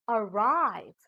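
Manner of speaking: "arrive" with RIVE lengthened; "arrive" demonstrated with emphasis on RIVE; stress on second syllable. Shown as a demonstration. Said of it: "put the stress on the second syllable".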